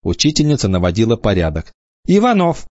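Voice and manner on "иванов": a high call to a pupil. Acted. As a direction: call the boy's name, first time. Teacher calling a child. (высоко, окликая)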